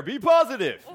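playful dad-joke tag; playful punchline. Real, not acted. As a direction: deliver the pun with a grin.

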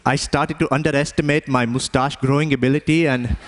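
put-on Indian accent, lifted for the comic tale. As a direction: tell it in Indian-accented English. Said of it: "have an Indian accent anymore".

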